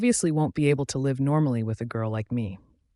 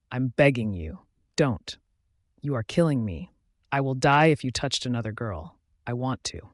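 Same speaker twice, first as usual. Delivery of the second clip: usual speaking voice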